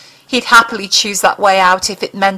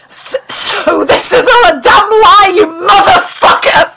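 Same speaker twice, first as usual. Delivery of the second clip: much higher, louder, sobbing shout; howled; 11 semitones higher, 6 dB louder than usual. Acted. Mulder howls at the alien that all is a lie, tearful shout. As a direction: howl it out in anguish and rage, slow at first. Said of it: "he howled".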